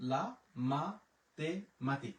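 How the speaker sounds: লা মাতেমাতিক stretched out, each syllable clear; slow careful demo. Shown as a demonstration. Teacher repeating the word for the class, very slow. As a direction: say it very slowly so learners can copy. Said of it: "এখানে পাইলাম হচ্ছে ক".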